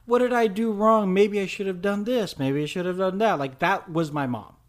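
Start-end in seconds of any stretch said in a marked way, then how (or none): 0.0-3.4 higher fretting mom voice; worried mother. Retold or imitated. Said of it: "to which they're like".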